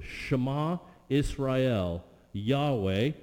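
slow Hebrew reading of Shema Israel. Shown as a demonstration. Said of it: "Shema is this word".

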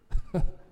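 short laugh. Real, not acted. Laughs at kicking mourners out.

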